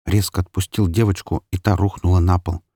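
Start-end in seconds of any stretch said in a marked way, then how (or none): none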